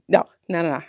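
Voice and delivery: ordinary talk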